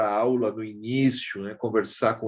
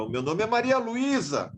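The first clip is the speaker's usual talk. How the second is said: a girl's retold shout, pitched up; retold yell; 9 semitones higher than usual. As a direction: call out the name high, imitating a girl. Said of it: "ela gritava o nome dela".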